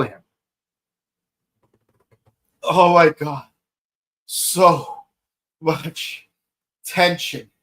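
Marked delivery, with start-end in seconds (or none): 2.6-7.5 breathless after fast telling; winded, catching breath between words. Real, not acted. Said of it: "I'm just exhausted and out of breath".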